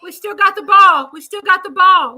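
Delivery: loud, high urgent calls; excited yelling. Real, not acted. Fan shouting at the Cowboys game on TV, loud and high. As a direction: yell it at the TV.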